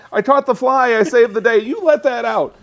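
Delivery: put-on high, loud eager boy; excited kid voice. Kid voiced in retelling film.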